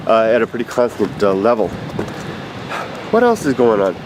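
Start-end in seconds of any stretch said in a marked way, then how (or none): none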